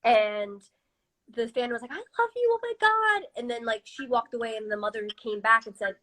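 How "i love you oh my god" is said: squealed high and excited, like a starstruck fan; gushing fan. Retold or imitated. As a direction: imitate the fan's giddy squeal. Fan gushing on meeting singer.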